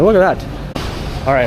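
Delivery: normal talking voice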